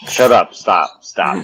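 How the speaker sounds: loud, laughing protest; laughing shout. Real, not acted. Cohost shouting at his friend to drop it, loud. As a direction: yell at him to stop. Friend teasing him on podcast.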